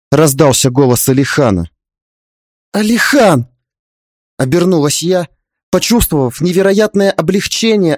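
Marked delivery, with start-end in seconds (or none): 2.7-3.5 high-pitched relieved call. Acted. Wife turning to her arriving husband, calling out. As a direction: call the name out, raised in pitch.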